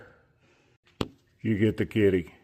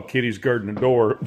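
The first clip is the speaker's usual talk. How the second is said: ordinary talk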